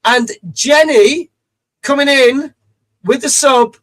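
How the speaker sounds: slow, loud drawn-out announcement; hype announcement. Real, not acted. Streamer announcing a subscriber to chat, loud and slow. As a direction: build the name up like a host.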